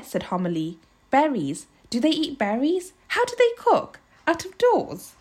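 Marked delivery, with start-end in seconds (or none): 1.1-5.1 high, fussy string of questions; high-voiced worried housewife; fretful questioning. A narrator's reading. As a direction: fret aloud, pitch jumping up. Homily frets with rapid questions.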